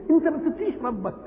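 high-pitched, indignant rebuke; reproachful question. Acted. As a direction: take a wrongdoer to task, pitch jumping up. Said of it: "تعرض بواحد يكون ظلم حد".

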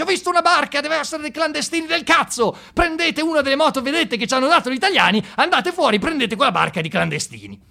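very high, loud barked orders; Gheddafi shouting orders. Retold or imitated. Gheddafi phoning the port authority, yelling very high and loud. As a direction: bark orders at the coastguard like a furious dictator. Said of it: "chiama in capitaneria di porto e dice".

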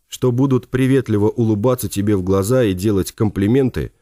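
plain reading voice

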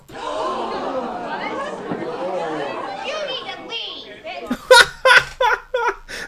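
long high burst of real laughter; helpless high cackle; laughing hard. Real, not acted. Host cracks up at clip.

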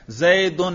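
"zaydun", زیدن with an u in the last syllable; nominative ending. Shown as a demonstration. Teacher asking which case was read, زیدن pronounced "zaydun". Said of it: "رفع".

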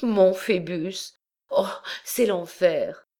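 a hint of despairing young girl; anguished lament. A narrator's reading. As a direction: mourn her lover in despair. Accused girl despairs for Phébus.

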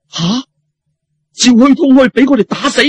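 high, loud incredulous cry; aghast; shocked disbelief. Acted. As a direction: blurt it in disbelief. Shocked couple reacts.

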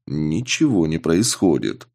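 ordinary delivery, nothing marked

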